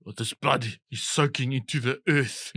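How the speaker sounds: put-on a light parody of a Hollywood South African accent; Leonardo's Blood Diamond accent. Ribbing Hollywood actors, a lightly done Blood Diamond South African accent. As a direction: mimic a movie star's fake Afrikaner. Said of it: "A Leonardo in Blood Diamond".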